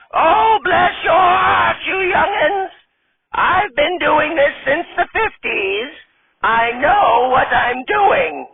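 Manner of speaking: high, slow, quavering granny voice; old lady voice. Acted. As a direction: play a sassy old grandma stripper.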